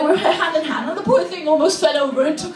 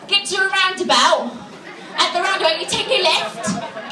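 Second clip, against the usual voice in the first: put-on higher-pitched chav accent; dragged-out chav English; British chav; 4 semitones higher than usual. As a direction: give directions like a British chav. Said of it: "a British chav would sound politer".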